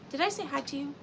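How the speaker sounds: usual speaking voice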